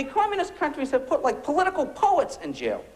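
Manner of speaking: normal talking voice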